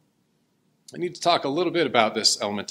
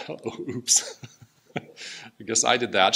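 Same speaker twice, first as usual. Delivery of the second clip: laughing; much slower than usual. Real, not acted. Laughs at her own slip.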